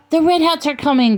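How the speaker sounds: put-on high-pitched child calling a warning; child's cry. A narrator's reading. Child warning the camp of raiders, high crying out. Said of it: "cried the child".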